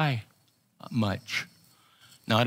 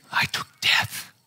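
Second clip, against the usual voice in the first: whispered declaration after a long pause; hushed whisper. Retold or imitated. The Lord consoling a bereaved son, in a hushed whisper. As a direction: drop to a whisper for the weight of it. Retold divine assurance, dramatic hush.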